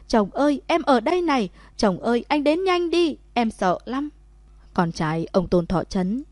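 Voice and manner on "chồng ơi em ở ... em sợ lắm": high frightened yell, much higher and louder; screaming for help. A narrator's reading. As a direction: shout with all her strength toward her husband. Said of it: "Cô ta ra sức gào hét". (gào to gọi chồng, giọng cao và sợ hãi)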